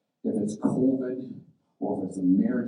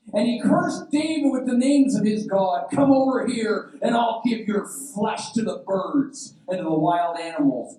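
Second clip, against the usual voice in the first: loud, high yelled threat; yelling; 8 semitones higher, 5 dB louder than usual. Acted. Goliath threatening David before battle, yelling. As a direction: yell it out as a cursing giant. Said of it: "Goliath yelled".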